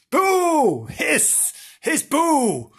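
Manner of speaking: high, loud panto boo and hiss; hammed-up boo and hiss; mock pantomime booing. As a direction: jeer the villains like a panto crowd.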